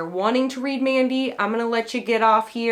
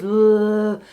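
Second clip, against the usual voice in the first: gravelly blah; 4 dB louder than usual. Retold or imitated. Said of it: "it was like this gravelly".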